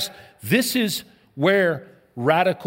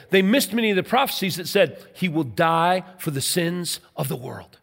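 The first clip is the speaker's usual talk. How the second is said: ordinary delivery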